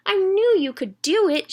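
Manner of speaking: high, loud proud mother voice. Acted. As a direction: hug her with your voice. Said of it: "she said".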